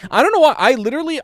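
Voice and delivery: high, loud exasperated rant. Real, not acted. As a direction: vent it, fed up. Venting about the Windows key.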